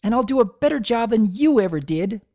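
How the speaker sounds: put-on high, loud child shouting back; filly yelling defiance after her mom; defiant yell. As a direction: hurl it back at her mother.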